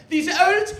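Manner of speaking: high, loud, slow monotone tirade; hammed-up activist speech, held on one high note; Greta Thunberg. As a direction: belt out the accusation slowly, mockingly. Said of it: "Greta Thunberg".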